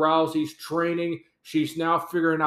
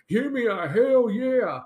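Austin catchphrase; 6 semitones higher than usual. Retold or imitated.

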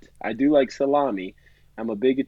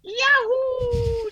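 Mario yahoo. Retold or imitated. Keeps doing Mario yahoo.

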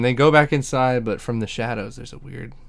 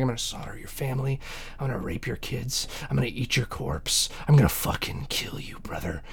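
hushed villain threats; 8 dB quieter than usual. Retold or imitated. Third Sister threatening everybody, mock-evil hushed menace. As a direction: menace them softly, sinking into a whisper.

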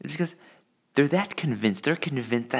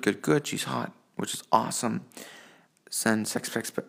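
normal talking voice

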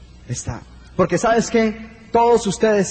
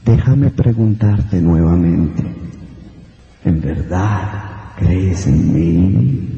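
much lower, slow, booming godlike voice; voice from above; 12 semitones lower, 4 dB louder, much slower than usual. Acted. Voice from above answers the hanging man, deep and slow. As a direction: speak down from the heavens, deep and deliberate. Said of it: "y la voz dice".

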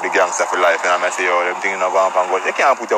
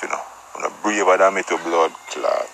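ordinary talk

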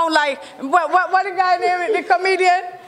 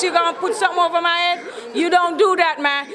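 ordinary talk, nothing marked

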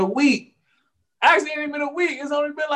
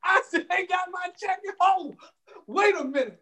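mock outrage; 7 semitones higher than usual. Playful indignation about his check.

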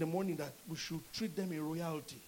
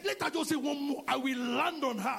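provoked shout; 6 semitones higher, 5 dB louder than usual. Retold or imitated. Son provoked, threatening.

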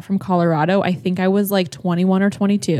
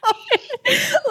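real high-pitched squealing laugh; high helpless laugh. Real, not acted. Cracking up while the kids listen, high helpless laugh.

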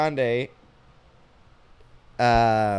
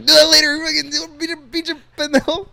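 much higher, loud mock scolding; imitating a pissed-off dad; 12 semitones higher, 5 dB louder than usual. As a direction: scold like an outraged dad, half-laughing. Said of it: "he gets so pissed".

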